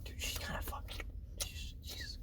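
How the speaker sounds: hushed, breathy whisper to a buddy. Acted. Luke whispering to Han about Leia, hushed. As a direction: whisper it so she won't hear.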